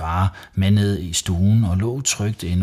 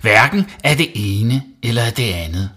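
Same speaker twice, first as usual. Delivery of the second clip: loud, higher-pitched firm refusal; stern; 5 semitones higher, 6 dB louder than usual. A narrator's reading. Mum denying playdates and dinner, stern. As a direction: rule out both things strictly. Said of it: "sagde mor stramt".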